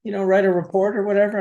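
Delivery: quick, high imitation of a cop's offer; casual cop.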